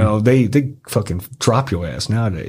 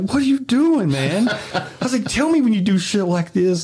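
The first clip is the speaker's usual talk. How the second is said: much higher, frantic mock yelp; panicked protest; 12 semitones higher than usual. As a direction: squeal it like a freaked-out driver. Panicked after the beating.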